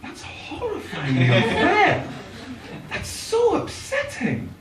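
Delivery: high, slow, hushed protest; mock-upset child. Child to mum after bad news, mock-upset. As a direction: squeak out the hurt, slowly and softly.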